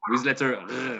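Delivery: ordinary talk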